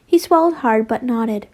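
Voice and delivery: ordinary delivery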